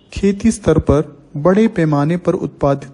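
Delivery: ordinary talk, nothing marked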